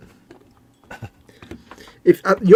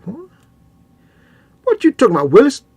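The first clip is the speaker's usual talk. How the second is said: louder, higher mock "What you talking about, Willis?"; hammed-up sitcom catchphrase, pitched up; 9 semitones higher, 5 dB louder than usual. Mocking himself to co-host in a hammed-up sitcom voice.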